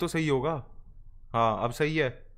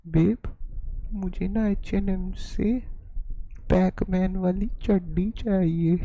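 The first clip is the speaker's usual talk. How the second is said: mock girlfriend pleading. Streamer imitating a girlfriend begging her boyfriend for socks. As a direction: wheedle like a needy girlfriend.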